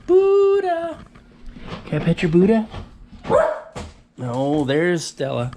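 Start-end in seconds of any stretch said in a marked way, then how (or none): 0.1-2.7 high, louder call to the pet. Real, not acted. Owner calling her pet, high and loud.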